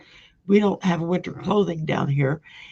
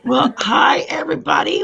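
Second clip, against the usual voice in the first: excited greeting; 6 semitones higher, 6 dB louder than usual. Real, not acted. Greeting the live audience.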